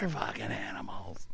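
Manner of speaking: low, whispered, defeated aside; imitating a stunned man's hushed complaint; near tears. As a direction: whisper it like a beaten performer. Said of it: "looks like he's in tears".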